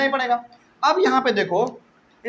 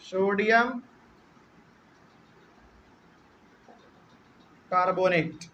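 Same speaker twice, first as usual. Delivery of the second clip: Sodium and carbonate said apart with long pauses, a little softer; slow dictation; 3 semitones lower, 4 dB quieter, slower than usual. Shown as a demonstration. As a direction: dictate it slowly, word by word, pausing for the class to write. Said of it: "नौवे number पे sodium carbonate". (धीरे-धीरे, हर शब्द अलग बोलकर लिखवाना)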